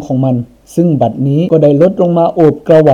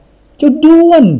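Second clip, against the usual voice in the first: much higher, louder cry of joy; joyful shout; 13 semitones higher, 5 dB louder, much slower than usual. A narrator's reading. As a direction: shout the elephant's name in delight. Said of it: "ร้องออกมาสุดเสียงอย่างปิติดีใจสุดขีด". (ร้องเรียกสุดเสียงด้วยความดีใจ)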